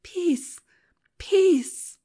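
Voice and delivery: crying out. Acted. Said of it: "as earnestly as if with a cry".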